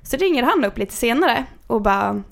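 usual speaking voice

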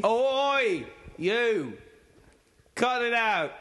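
high-pitched Cockney holler; drawn-out mock Cockney cry; Cockney accent. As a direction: yell like a Cockney chimney sweep. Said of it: "put on the accent".

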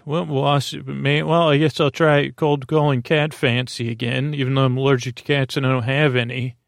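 normal talking voice

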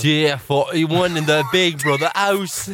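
slow, drawn-out Geordie announcer; Big Brother voiceover. Acted. Playing reality show narrator to co-host, Geordie announcer voice. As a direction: announce it slowly in a put-on Geordie accent. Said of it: "It's a good Geordie accent".

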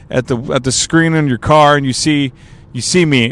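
ordinary delivery

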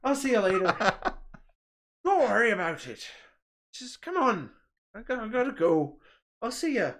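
high, loud Irish impression; Irish accent. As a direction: say goodbye in a put-on Irish lilt. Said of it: "speaking with an Irish accent".

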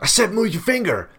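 high, loud mock yell of a passport officer; angry officer yell. Retelling a rude officer yelling at his girlfriend, high loud mock yell. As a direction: bark the order like a rude border guard.

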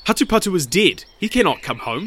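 high, emphatic dismissal; scornful denial. A narrator's reading. Brothers reject the news of his return, emphatic denial.

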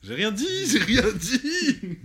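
very high, loud squealed denial, stretched out; mock protest. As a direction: squeal your innocence in falsetto, laughing at the end. Se défend en plaisantant après un silence. (fausse protestation criée d'une voix suraiguë)

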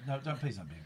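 ordinary talk, nothing marked

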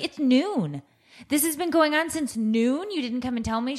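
incredulous mom telling off, re-enacted; retold scolding. Retold scolding of her child.

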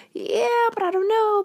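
very high hesitant squeak; doubtful inner voice. Retold or imitated. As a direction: play the nervous inner doubter. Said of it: "some part of you is like".

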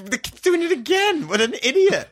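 very high, squawking disbelief; exasperated mock outburst. Retelling Yusuke seeing the kid do it again, exasperated. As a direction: play up his exasperation, voice pitched right up.

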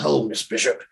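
a lightly voiced, measured crime boss greeting; Wilson Fisk voice. Imitating the Kingpin greeting Kate's mom, lightly voiced. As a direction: ease into it like a calm villain. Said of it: "That's how he talks".

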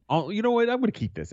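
high, playful take-back voice; high mock greedy voice. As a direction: squeak it up, pretending to hoard. Acting giveaway host.

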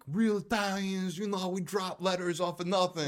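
high, slow put-on voice mocking Italians; mock Italian American. Mocking Italian Americans to his cohost, high and slow. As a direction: ham up a self-proclaimed real Italian. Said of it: "they're like".

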